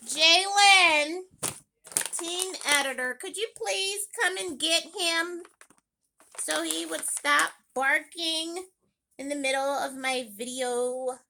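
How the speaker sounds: loud call across the house. Real, not acted. Mom calling her son to fetch the barking dog, loud call. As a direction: call him from another room.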